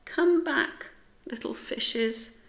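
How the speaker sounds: high, wistful plea; longing sigh after something slipping away. A narrator's reading. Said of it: "Splat sighed".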